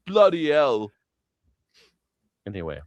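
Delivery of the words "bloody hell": slow, mock English exclamation; hammed-up Brit exclaiming; mock English accent. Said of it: "you mimicking an English person".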